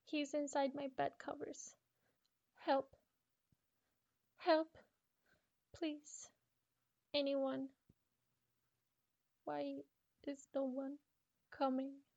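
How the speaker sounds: high, cries of help; desperate girl. Acted.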